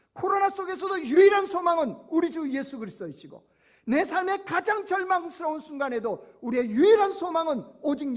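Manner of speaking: high, loud impassioned sermon delivery; preaching at full force. Acted. Pastor rallying the congregation during Covid, loud impassioned preaching. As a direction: proclaim it to the back pews.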